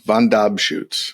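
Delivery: Von Dobschutz said slowly, with DOB as the strong middle syllable; name pronunciation demo. Shown as a demonstration. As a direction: show how to say Von Dobschutz, slowly and distinctly. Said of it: "help us pronounce your last name".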